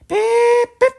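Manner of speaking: very high, loud whistle beeps; train whistle beep. Acted. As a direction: toot like an engine whistle. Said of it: "he whistled".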